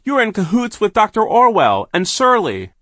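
high loud accusing shout. A narrator's reading. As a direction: keep yelling at him. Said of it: "Violet shouted".

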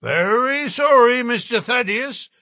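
high, firm refusal from the porter; inexorable. A narrator's reading. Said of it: "said the porter inexorably".

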